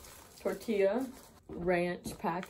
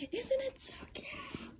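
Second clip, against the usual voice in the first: high, soft, breathy little whisper; cutesy squeal; 7 dB quieter, slower than usual. Real, not acted. Showing mom's pillow to viewers, high cutesy whisper. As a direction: coo over the pillow in a tiny high voice.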